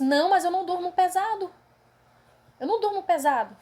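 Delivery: protesting the night curfew story; insistent denial. Retold or imitated. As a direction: insist you don't sleep heavily. Retold insisting, arguing.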